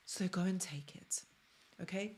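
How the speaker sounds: breathy conspiratorial whisper; stage whisper. Acted. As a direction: whisper it like a secret to the viewers. Conspiratorial aside about narcissists.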